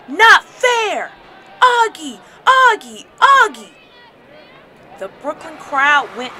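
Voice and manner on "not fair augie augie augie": high, full-voiced outraged shout; screamed protest. A narrator's reading. Father protesting the spiking of his favorite player, screaming. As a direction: yell it out, then chant the name hard. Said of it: "he screamed".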